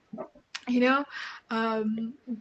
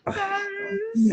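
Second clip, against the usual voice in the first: drawn-out mock embarrassment; 10 semitones higher, much slower than usual. Exaggerated embarrassment.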